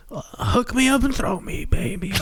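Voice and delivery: put-on high, drunk slurred voice; a lightly voiced drunk guy at the bar; drunken slur. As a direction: play a wasted guy slurring. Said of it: "it does sound very drunken".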